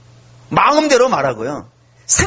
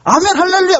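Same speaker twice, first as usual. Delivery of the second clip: excited amen; 10 semitones higher than usual. Retold or imitated. Preacher imitating believers answering a testimony, rousing amen. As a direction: call out like a fired-up congregation. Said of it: "막 이렇게 하지 않았겠어요?".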